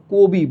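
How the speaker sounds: কবি with closed o, kobi. Shown as a demonstration. Teacher confirms what we say, কবি with o. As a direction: round the first vowel of কবি to o. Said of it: "কো হয়ে যাচ্ছে".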